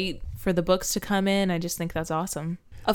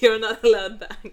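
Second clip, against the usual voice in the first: laughing; 4 semitones higher, 6 dB louder than usual. Real, not acted. Laughing echo of joke.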